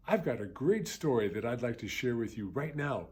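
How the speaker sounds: plain reading voice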